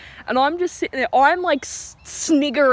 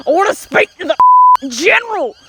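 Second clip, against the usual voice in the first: gruff tough guy, louder and higher; loud tough guy demand; 9 semitones higher, 9 dB louder than usual. Retold or imitated. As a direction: bark the demand like a bully. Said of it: "and he's like".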